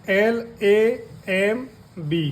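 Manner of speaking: spelled out letter by letter, very slowly; slow spelling. Shown as a demonstration. Teacher spelling the word slowly for students. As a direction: sound out each letter slowly. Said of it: "दूसरे उदाहरण".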